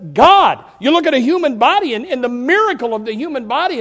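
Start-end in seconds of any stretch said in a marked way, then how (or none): 0.1-0.6 shouted God. Pretend. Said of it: "everything says".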